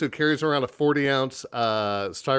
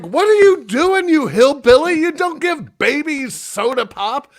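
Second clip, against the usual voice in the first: high, loud scolding yell; shocked shouting at the aunt; retold yell; 12 semitones higher, 7 dB louder than usual. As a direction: call her out in disbelief. Yelling at aunt again.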